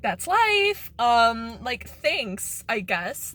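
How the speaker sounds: loud sarcastic brush-off; high, loud, offhand shrug; sarcastic retort. Retold or imitated. Her retorted reply, loud high.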